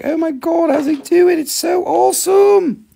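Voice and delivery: high, gushing oh my God; mock gushing fan. Seller imitates a thrilled buyer, high gushing squeal. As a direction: play an amazed viewer squealing. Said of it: "It's like".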